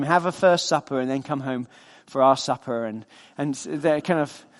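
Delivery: normal talking voice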